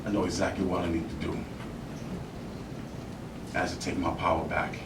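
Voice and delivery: low, soft determination; quiet resolve. Acted. Actor taking their power back, quietly resolved. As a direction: state it quietly and firmly.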